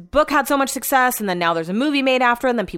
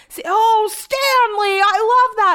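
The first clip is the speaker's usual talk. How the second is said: put-on much higher, louder gushing old caller; 12 semitones higher, 4 dB louder, slower than usual. Imitating the caller gushing over the fake dog name, high and loud. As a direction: coo over the dog's name.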